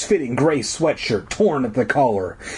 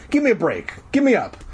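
raised pitch, held-back yell; mock yell; 9 semitones higher, faster than usual. A narrator's reading. Girl stuck below tells Zeke to help, mild yell. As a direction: yell it lightly, voice lifting high. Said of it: "I yelled".

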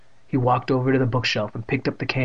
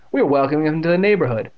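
smug; 4 semitones higher, 5 dB louder, faster than usual. A narrator's reading. Bully twin smirking excuse.

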